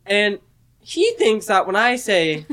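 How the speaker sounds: usual speaking voice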